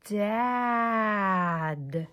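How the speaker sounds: Dad blended in one smooth sweep. Shown as a demonstration. Teacher shows class the smooth way, one blended word. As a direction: glide through Dad without a break. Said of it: "Now let's do it smooth".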